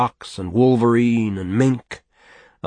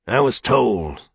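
hoarse whisper; 3 semitones higher, slower than usual. A narrator's reading. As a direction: breathe it out in a hoarse rasp. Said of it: "said his hoarse whisper".

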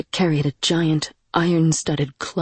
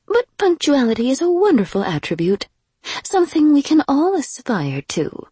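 higher, prim, reproving lady's voice; haughty noblewoman; 8 semitones higher, 3 dB louder than usual. Acted. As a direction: chide him like a condescending aristocrat. Lady scolds the demon.

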